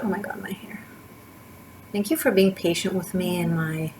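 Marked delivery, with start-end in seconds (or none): 0.0-0.8 breathy whispered aside. Real, not acted. Fussing over her own hair mid-reading, whispering to herself. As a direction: whisper it to yourself, half-distracted.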